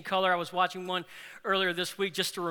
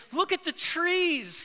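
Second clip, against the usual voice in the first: much higher, slow and awestruck; excited wonder; 10 semitones higher, slower than usual. Retold or imitated. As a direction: marvel at the colours for the first time. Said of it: "He's so excited".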